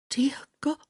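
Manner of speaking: breathless high whisper; awed whisper. A narrator's reading. Woman seeing her lover undressed, awed whisper. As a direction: breathe it out in awe.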